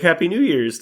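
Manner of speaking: high, loud greeting without a question; bright-pitched, loud well-wishing; cheerful retold greeting. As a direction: call out a happy greeting. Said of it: "I was like".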